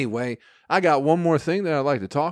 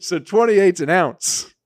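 laughing; 6 semitones higher, 9 dB louder, slower than usual. Real, not acted. Laughing at own math mix-up.

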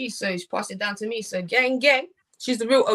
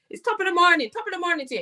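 higher-pitched mock Irish accent; 5 semitones higher than usual. Shown as a demonstration. Asked for Scottish, lands in an Irish accent by mistake. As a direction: attempt a lilting Irish accent. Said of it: "that's Irish, no? Wrong one".

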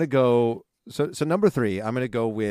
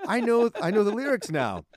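much higher-pitched mock realisation; retold sudden confidence; 10 semitones higher than usual. Host retelling getting into the groove, mock excited. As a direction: act out thinking you've suddenly got it. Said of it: "I was like, "Oh".

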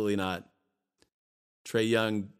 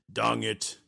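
mock Russian accent; "dang it" in a put-on Russian accent. Podcaster cursing his own card buys, mock Russian accent. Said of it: "my Russian accent".

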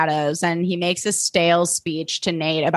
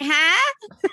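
mock confusion; 11 semitones higher than usual. Reacting to plot twist.

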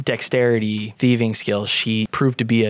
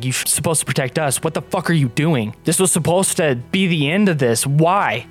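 raging at Mike; 5 semitones higher than usual. Acted. Hope rages at Mike over the dead squad, rising fury. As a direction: pound his chest with every word. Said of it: "in a rage".

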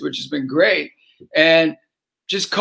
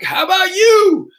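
calling out; 11 semitones higher, 6 dB louder than usual. Retold or imitated. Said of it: "So I'm like".

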